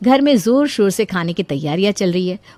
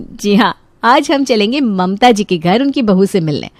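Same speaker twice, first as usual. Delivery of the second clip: plain reading voice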